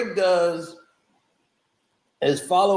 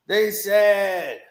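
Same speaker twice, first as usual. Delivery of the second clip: ordinary talk, nothing marked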